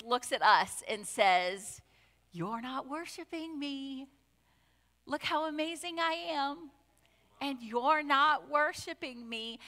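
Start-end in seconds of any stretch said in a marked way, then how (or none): 2.3-9.6 soft singy-songy actress voice; high, lilting singsong put in the Lord's mouth; high-pitched singsong. Retold or imitated. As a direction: mimic the actress's musical complaint, lightly. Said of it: "I don't think he does it in that high-pitched, singy, songy voice".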